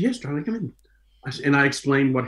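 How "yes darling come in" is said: high, sugary "darling" voice; sweet agent voice. Retold or imitated. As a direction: coo it like a gushing agent.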